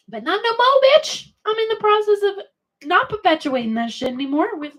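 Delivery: high, loud sassy declaration; high sassy defiance; defiant. Real, not acted. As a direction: sass it out, done with it.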